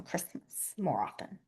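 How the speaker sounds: near-voiceless whisper; whispered demo. Shown as a demonstration. Teacher demonstrating the Christmas sign, whispered. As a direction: mouth the word barely aloud. Signing demo, word softly mouthed.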